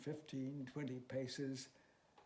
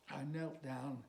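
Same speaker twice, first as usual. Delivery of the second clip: plain reading voice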